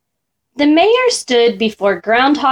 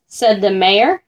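usual speaking voice

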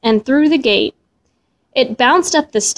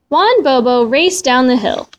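usual speaking voice

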